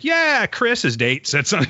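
very high, sing-song mock of his sister; sister bursting the bubble. Sister telling Chris the lookalike's date said something, teasing high voice. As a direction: pop his fantasy with a teasing lilt. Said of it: "she's like".

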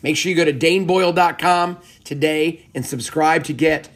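rally the audience like a closing promo read; upbeat podcast outro appeal. Acted.

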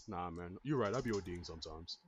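mocking pushover. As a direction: play the spineless friend caving in.

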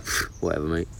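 low, quiet dismissive brush-off; dismissive quote. Retold or imitated. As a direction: mimic players who can't be bothered. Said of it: "The rest of Arsenal were like".

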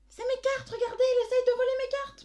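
high, loud shrill accusing yell; mock shrieking. Sister mimics the lady yelling at their mother, loud mock shriek. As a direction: shriek it like a woman crying thief. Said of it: "et qui hurle".

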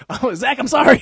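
high, loud call while fleeing; mock apologetic yell. Friend abandons Zach and runs off, mock apologetic yell. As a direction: shout a hammed-up apology over your shoulder.

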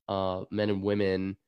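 ordinary talk

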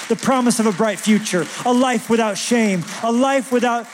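urgent gospel plea, pitched high; impassioned appeal. Acted. Preacher urging people to trust Jesus today, impassioned. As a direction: press the invitation home, high and insistent.

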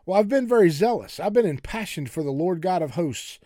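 Elijah's self-pitying protest; woe is me. Acted. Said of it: "This is a real woe is me moment".

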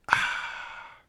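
long unvoiced exhale; breathy sigh. Reacting to two-hour drive.

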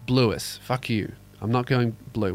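normal talking voice

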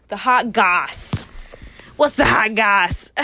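loud, playful gossip call; gossipy hype. Pretend. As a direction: hype up the hot goss.

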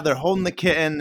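usual speaking voice

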